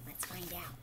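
soft whispered teaser. Real, not acted. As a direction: hush the class with a secret.

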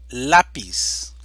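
lápiz dicho fuerte, golpe en LÁ; ejemplo enfatizado. Shown as a demonstration. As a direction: marca fuerte la sílaba LÁ de lápiz. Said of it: "llanas o graves con tilde". (lápiz, con fuerza en LÁ)

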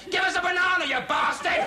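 high-pitched abusive yell; jeering crowd shout. Acted. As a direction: shout it like a pointing, jeering mob.

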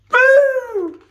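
high, loud playful boo; kitten's boo. Acted. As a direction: pounce on it like a tiny kitten. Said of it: "little yellow kitten went".

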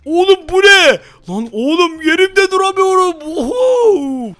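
high, loud amazed outburst; surprised. Real, not acted. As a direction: shout it out in shock and delight. Sudden speed power surprise.

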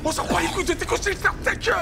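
high furious yell; angry yelling. Acted. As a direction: scream him down. Yelling at Manu on radio.

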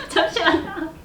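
stifled giggles. Real, not acted. Trying to stop laughing.

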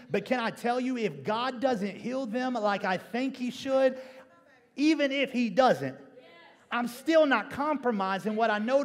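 loud, high, impassioned preaching that keeps climbing; preaching at full force. Acted. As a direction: preach it hard to the congregation and build to a roar. Preacher building to full-force climax.

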